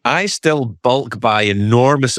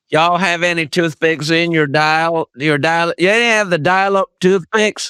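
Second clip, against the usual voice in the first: mock Southern accent; 6 semitones higher than usual. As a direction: ask the diner for toothpicks Southern style. Brit mocking Southern diner request.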